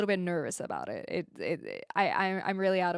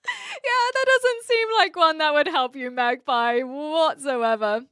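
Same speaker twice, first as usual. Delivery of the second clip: laughing; 6 semitones higher, 8 dB louder, slower than usual. Real, not acted. Laughing at a chat message.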